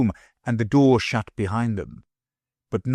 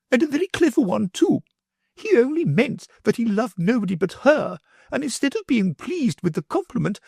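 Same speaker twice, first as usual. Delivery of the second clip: high, peevish lady's voice; fussy mother, high and put out; indignant mother. A narrator's reading. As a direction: defend her son, sniffy. Mother's voice held.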